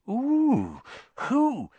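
high, drawn-out, eager curiosity; keenly. Acted. Parvati wanting to know Hermione's partner, keenly. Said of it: "she said keenly".

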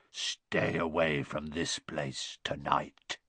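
low, slow, soft croak. A narrator's reading. Harry warning Peeves off, low croak. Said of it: "croaked Harry".

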